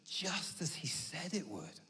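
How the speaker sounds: slow, soft retold wonder; hushed realization. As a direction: let it land slowly and quietly. Imagined disciples marvel at the resurrection.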